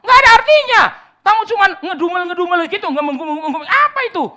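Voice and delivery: loud, high mocking preach; preaching at full force. Preacher ridicules meaningless babble to listeners, loud. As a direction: scoff at the empty babble.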